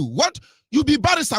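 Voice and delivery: ordinary talk, nothing marked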